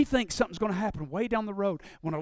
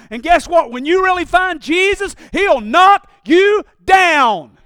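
normal talking voice

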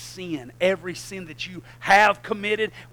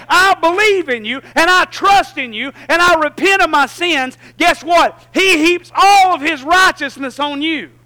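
higher and louder, pounding the point home; full-force preaching; 9 semitones higher, 12 dB louder than usual. Acted. Pastor preaching the cross to the church at full force. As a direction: preach it out with everything.

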